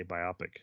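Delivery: "biopic" as bi-OP-ic, low and soft; biopic rhyming with myopic. Shown as a demonstration. Owning up to an odd pronunciation, "biopic" as bi-OP-ic. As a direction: quietly say "biopic" to rhyme with myopic. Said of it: "But I say biopic".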